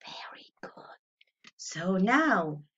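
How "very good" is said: whisper. Real, not acted. Whispered praise after animal game.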